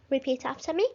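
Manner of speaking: ordinary talk, nothing marked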